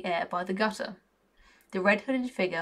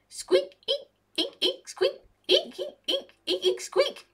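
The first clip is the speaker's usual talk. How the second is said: very high squeaking chatter, fading; excited high rat chatter; high rat squeaks and eeks. A narrator's reading. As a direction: squeak away like a tiny rat telling a story.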